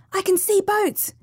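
very high, excited cry at normal volume. A narrator's reading. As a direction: exclaim high, as if spotting rescue. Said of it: "cried Sylvia".

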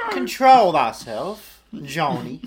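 loud, very slow southern scolding; southern accent. Pretend. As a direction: drawl the rebuke out grandly. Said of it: "I love that fucking accent".

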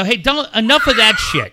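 worked-up telling-off; retold heated scolding. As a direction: re-enact shutting someone down sharply. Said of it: "he knows I'm heated up".

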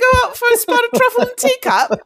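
high, loud hammed-up Scottish accent; mock Scottish accent. Mock Scot inviting the lads.

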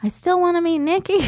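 high, innocent reassurance; squeaky denial from the dad; mock dad voice.

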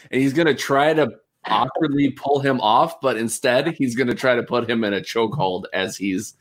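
usual speaking voice

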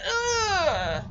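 long, drawn-out groan of disgust; exasperated groan. Retold or imitated. Recapper fed up with Stephanie's cookie demand, exasperated groan. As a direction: let out a long, soft "ugh".

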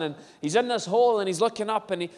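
usual speaking voice

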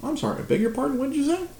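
high feigned innocence toward the coworker; mock innocent. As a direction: play dumb in a high sweet voice. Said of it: "And I was like".

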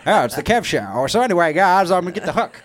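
high, loud mock showman; loud, high-pitched comic put-on; hammed-up self-mockery. Mimics a mock stage announcement.

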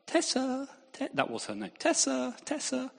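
high calling "Tessa"; calling the dog. Retold or imitated. Boy calling the missing dog through the house, high call. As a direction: sing out her name across the house.

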